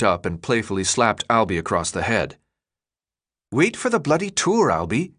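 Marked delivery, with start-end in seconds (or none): 3.5-5.1 put-on high British-style accent, playful; thick odd accent. A narrator's reading. Second boy chiding the leader, thick odd accent. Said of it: "his voice thick with an odd accent".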